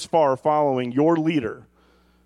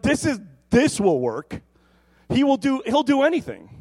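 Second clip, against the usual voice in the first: high, eager acted hope; excited disciples; 10 semitones higher, 4 dB louder than usual. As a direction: insist in mock excitement that it will work. Voicing hopeful disciples.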